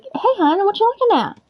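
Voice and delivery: put-on talking sweetly to a child, high; sweet to a kid. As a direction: coo at the little boy. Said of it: "I was like".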